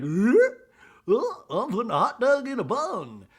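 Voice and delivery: high, loud, strained silly voice; hammed-up high-pitched parody; mock grimace voice. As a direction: squeal it in a put-on cartoon voice. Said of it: "makes this face like".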